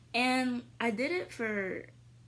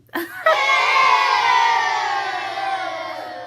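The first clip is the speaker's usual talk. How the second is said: loud laugh; 16 dB louder than usual. Real, not acted. Laughing at own accent attempt.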